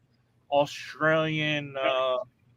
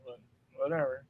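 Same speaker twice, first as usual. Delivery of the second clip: normal talking voice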